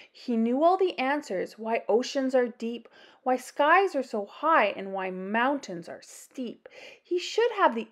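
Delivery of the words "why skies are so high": ordinary delivery, nothing marked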